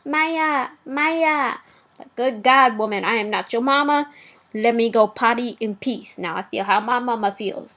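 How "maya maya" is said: imitating needy kid. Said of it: "She will stand outside the bathroom".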